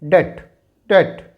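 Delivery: डेट said as det, the b silent; silent b demo. Shown as a demonstration. Teacher showing students the silent b in डेट. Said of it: "इसका उच्चारण हो जाता है".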